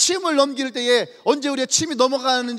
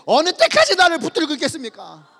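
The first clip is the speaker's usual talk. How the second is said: louder, laughing, exasperated; annoyed whine; 4 semitones higher, 5 dB louder than usual. Acted. Job complaining to God, louder and laughing in exasperation. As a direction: whine it like someone sick of being clung to. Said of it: "지금 욥이 지금 그렇게 얘기하는 거예요".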